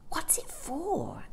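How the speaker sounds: soft awed whisper, slow. A narrator's reading. Jane whispers to Mary Poppins in awe. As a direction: breathe the question in wonder.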